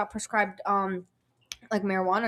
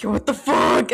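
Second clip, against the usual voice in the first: loud mock alarm from a friend; 7 dB louder than usual. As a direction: blurt it in startled disbelief. Friends alarmed by beeping watch.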